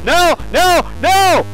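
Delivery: high panicked shouts of no; panicked screams. Real, not acted. Startled by the dragon.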